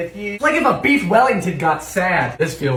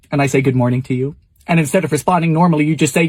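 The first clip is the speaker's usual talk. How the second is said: ordinary talk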